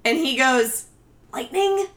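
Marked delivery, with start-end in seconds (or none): 1.3-1.9 higher hesitant guess; Tommy impression. Tommy unsure about the horse's name, high questioning. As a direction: offer the name like a shy kid. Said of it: "That was a good impersonation".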